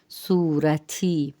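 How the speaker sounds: سورتھی slowly as "soo-ra-thee", h after the t; aspirated t. Shown as a demonstration. Teacher models Persian pink for learners, slow aspirated pronunciation. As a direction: draw out سورتھی with a breathed t. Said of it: "تا کے ساتھ ہا کی آواز".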